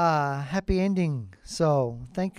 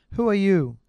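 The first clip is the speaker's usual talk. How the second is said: a sharp, much louder challenge; angry demand; 3 semitones higher, 5 dB louder than usual. Acted. King confronting the poor guest at the party, loud and angry. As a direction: bark it at the uninvited stranger.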